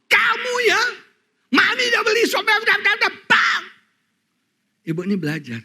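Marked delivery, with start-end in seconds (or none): 0.1-3.6 loud, high scolding mom; mock furious mother yelling at her kid; angry mother scolding. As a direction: snap at the child like a furious mother. Mother scolding child over watermelon.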